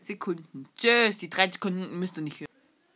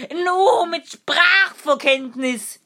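hammed-up punchline; 9 semitones higher, 7 dB louder, slower than usual. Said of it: "Wer sitzt auf dem Baum und sagt".

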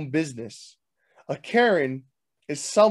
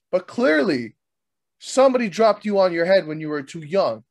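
ordinary talk, nothing marked

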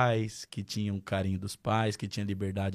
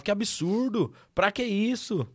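mock indignation; 11 semitones higher, slower than usual. People scoffing at father's rules.

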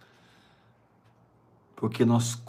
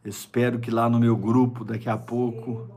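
ordinary talk, nothing marked

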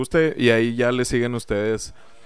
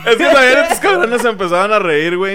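laughing; 10 semitones higher, 10 dB louder, slower than usual. Real, not acted. Still laughing about the sketch.

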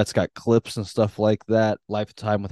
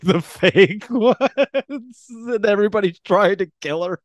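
laughing while talking, slow and high; 9 semitones higher, slower than usual. Real, not acted. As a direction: talk through the giggles. Laughing through words, slowed.

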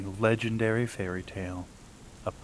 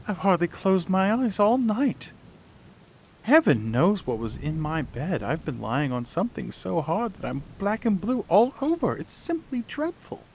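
high dramatic princess voice, rising higher; cartoon princess; 9 semitones higher than usual. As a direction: play the fussy princess, high and aggrieved. Princess complains in story.

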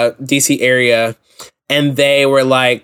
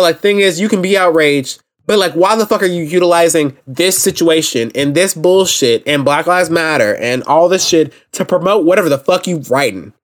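ordinary delivery

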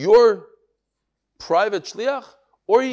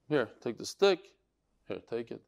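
put-on quiet old man; 7 semitones lower, 7 dB quieter than usual. Old man hands over stick.

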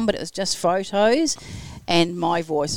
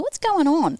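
surprised; 11 semitones higher, slower than usual. Retold or imitated. Thinking aloud about buzzing wrist.

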